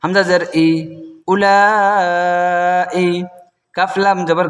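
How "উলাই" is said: উলাই chanted with a greatly stretched middle vowel; long four-alif madd. Shown as a demonstration. Teacher showing four-alif madd on উলাই, the লা drawn out. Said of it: "চার আলিফ মধ্যে মুত্তাসিল".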